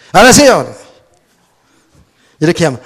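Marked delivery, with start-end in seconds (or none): 0.1-0.8 a loud, sulky "알았어요" flung at a parent; sulky teen answering back; sulky child. Retold or imitated. Defiant child answering a parent, loud sulky. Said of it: "삐딱해가지고 부모니까".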